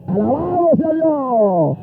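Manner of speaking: high, long-held preacher's cry; praise shout. Real, not acted. Preacher praises God after the embrace, exultant. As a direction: send the praise up high and draw it out.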